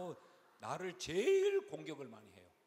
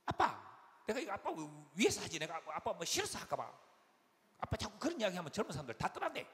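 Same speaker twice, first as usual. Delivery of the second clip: imitated daughter pleading, pitched up; nagging younger daughter; 4 semitones higher, 4 dB louder than usual. Second daughter cautioning dad about his preaching, nagging. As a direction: press the point on dad in a raised voice. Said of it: "그러면서 하는 이야기가 그래요".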